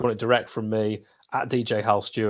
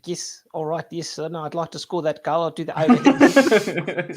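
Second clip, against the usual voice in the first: put-on South African accent; 6 semitones higher, 4 dB louder than usual. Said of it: "that was a South African accent".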